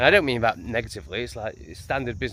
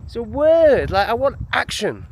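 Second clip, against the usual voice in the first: mock indignant; 12 semitones higher, 5 dB louder than usual. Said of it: "Like, you know".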